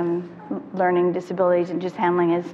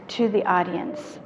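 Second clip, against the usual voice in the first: normal talking voice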